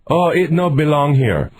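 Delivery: lightly put-on broken-English accent; mock accented clerk; mock foreign voice. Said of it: "do the voice like you were doing it".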